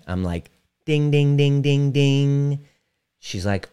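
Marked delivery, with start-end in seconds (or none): 0.8-2.7 ringing out a high, louder ding-ding like a game-show bell; playful bell sound, higher and louder; mock ding bell. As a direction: chime it like you just hit the jackpot. Mock alarm bell on client insight.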